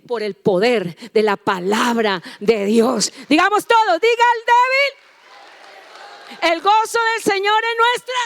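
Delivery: building from loud emphasis to high full shout; rousing preaching. Real, not acted. Preacher leads all in declaring joy of the Lord, shouted rallying. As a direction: belt it out to rally everyone.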